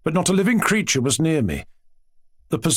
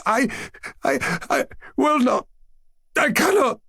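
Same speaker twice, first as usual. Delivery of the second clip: very high, tearful broken gasps; gasped, sobbing child's protest; gasping; 12 semitones higher than usual. A narrator's reading. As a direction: stammer a refusal through choking tears. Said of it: "I gasped".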